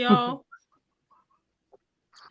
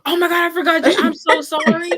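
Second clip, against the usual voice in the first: high, loud burst of apology; real mortified gush, pitched up; flustered apology; 7 semitones higher, 5 dB louder than usual. Real, not acted. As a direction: blurt out a horrified, laughing sorry. Apologising after forgetting Jen.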